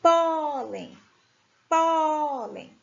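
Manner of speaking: drawn-out PÓ, falling "len" in "pólen"; stress on PÓ. Shown as a demonstration. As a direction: stretch the first syllable of "pólen". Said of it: "O pó é a penúltima sílaba".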